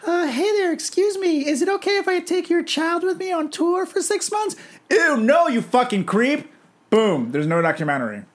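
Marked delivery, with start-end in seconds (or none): 0.1-4.6 falsetto voice. Retold or imitated. Imitating [name] asking parents. 4.9-6.5 angry yell. Retold or imitated. Parent rejecting the request.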